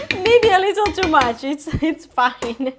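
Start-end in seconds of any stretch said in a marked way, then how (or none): 0.1-1.4 laughing, louder and higher; sheepish laughing confession; laughing admission. Real, not acted. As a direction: giggle it out while owning up. Admits too much pesto, laughing.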